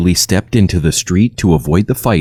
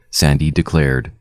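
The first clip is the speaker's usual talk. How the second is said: plain reading voice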